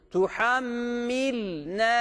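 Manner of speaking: "Tuhammilna" slowly with a held double m; correct recitation. Shown as a demonstration. Teacher gives the correct "Tuhammilna" to the class. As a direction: hold the m in "Tuhammilna". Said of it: "diye okuyoruz".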